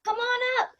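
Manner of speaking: high-pitched call down the stairs; high, carrying child's call; calling back. Acted. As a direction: sing out an invitation to someone below.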